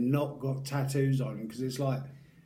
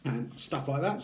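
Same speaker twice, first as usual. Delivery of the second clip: ordinary talk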